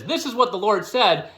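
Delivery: playful mock Southern preacher; Southern accent. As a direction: imitate a Southern guy quoting the Lord. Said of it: "a Southern guy comes and says".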